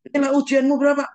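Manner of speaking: father loudly demanding his son's exam score; stern demand. Retold or imitated. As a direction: bark the question at the son.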